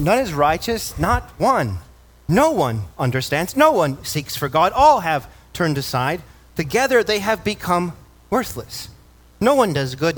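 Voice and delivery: each line pressed and rising; emphatic scripture reading. Acted. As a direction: proclaim the verses with weight.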